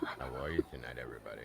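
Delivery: deep, smooth announcer greeting; radio announcer voice. Acted. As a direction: greet them like a late-night DJ. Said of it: "with the radio announcer voice".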